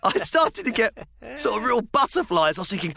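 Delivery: usual speaking voice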